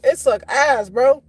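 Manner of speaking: normal talking voice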